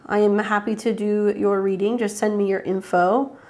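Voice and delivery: normal talking voice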